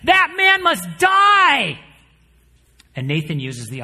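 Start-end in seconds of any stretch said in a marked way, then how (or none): 0.0-1.8 slow, high, loud outraged cry; furious shout. Acted. King David furious at the rich man, shouting. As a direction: roar out the king's verdict in fury. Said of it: "David is, like, furious".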